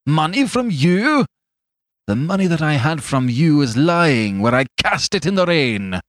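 high, scornful outburst; indignant cry. A narrator's reading. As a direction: fling the offer back in outrage. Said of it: "cried Fetz".

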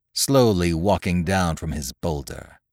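plain reading voice

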